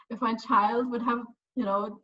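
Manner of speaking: usual speaking voice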